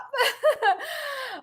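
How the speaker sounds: high, quick giggle; amused laugh. Real, not acted. Host amused by a viewer sassing the others, high giggle.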